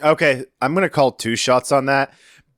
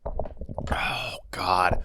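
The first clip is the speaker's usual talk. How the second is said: hushed, voiceless exclamation; overwhelmed whisper; 3 semitones lower, 7 dB quieter than usual. Real, not acted. Listener told to wait for Marcia, whispering in anticipation. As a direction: whisper it under your breath.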